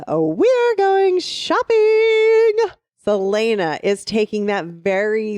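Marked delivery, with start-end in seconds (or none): 0.1-2.6 much higher, very slow squeal of delight; excited shopping girl. Pretend. Co-host cheers on Celaena's shopping trip in a high giddy squeal.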